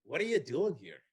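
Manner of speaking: a deliberately bad Irish accent, hammed up; jokey mock Irish accent, not quite convincing. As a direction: put on your worst Irish brogue for a passing local. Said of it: "here's my worst Irish accent".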